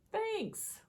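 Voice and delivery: high-pitched bear voice; squeaky high thanks. Acted.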